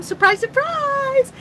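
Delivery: high-pitched drawn-out mock surprise; playful catchphrase. Host greeting a viewer who just popped up, playful high surprise.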